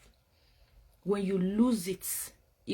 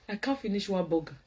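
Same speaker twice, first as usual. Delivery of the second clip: laughs it out, pitched up; laughing; 7 semitones higher than usual. Real, not acted. Laughing to her live viewers about failing a burger. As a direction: giggle through the admission.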